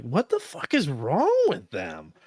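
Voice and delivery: slow, disbelieving mock outburst; incredulous disgust. Husband listening to wife's podcasts, baffled disgust. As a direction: spell out your disgust at them, slowly. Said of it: "I'm like".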